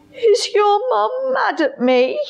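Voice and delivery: high, anxious lion voice; a timid lion asking; worried lion. A narrator's reading.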